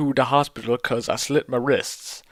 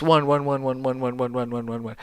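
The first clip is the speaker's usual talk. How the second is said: fading ones; 4 dB quieter, faster than usual. Acted. Reader mocking the author's exclamation marks, fading out. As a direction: chant of ones that fades softer.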